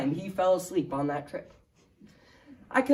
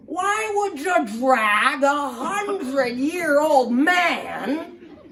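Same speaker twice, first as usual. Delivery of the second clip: put-on higher, louder old-timer accusing; Ed complaining to the driver at camp; suspicious old codger; 8 semitones higher, 4 dB louder than usual. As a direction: whine that he's being dragged off to be murdered.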